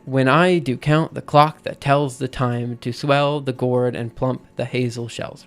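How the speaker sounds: slow scansion, stress every second syllable from "when I do count"; iambic reading. Shown as a demonstration. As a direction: read "when I do count the clock" as steady da-DUM pairs. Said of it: "syllable followed by a stressed syllable".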